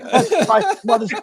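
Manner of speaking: mock sobbing kid. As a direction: play the kid falling apart in tears, half-laughing. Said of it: "he's crying so hard he can't catch his breath".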